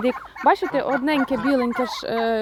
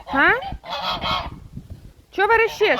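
high teasing scold; 8 semitones higher than usual. Real, not acted. Scolding the young gander. (високо і грайливо сварить гусака)

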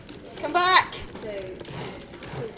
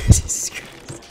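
breathless oath; 5 dB louder than usual. Real, not acted. After the scare.

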